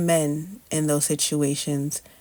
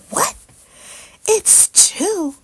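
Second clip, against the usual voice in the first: stunned disbelief; 6 dB louder, much slower than usual. Retold or imitated. Disbelief at two sacs.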